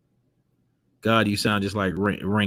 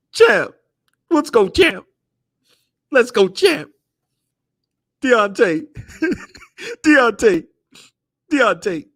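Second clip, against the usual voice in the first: mocking cheerleader voice; 12 semitones higher than usual. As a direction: squeal 'champ' high to taunt him. Mocking Wilder in high voice.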